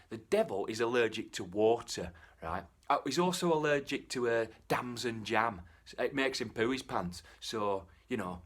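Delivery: ordinary delivery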